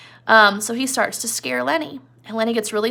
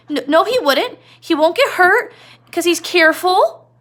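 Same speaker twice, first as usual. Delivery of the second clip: high, louder stammer, slowing; scared Lenny; 8 semitones higher, 7 dB louder than usual. Retold or imitated. Lenny insists George is careful, high and frightened. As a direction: insist through fear, higher and louder. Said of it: "And Lenny's like".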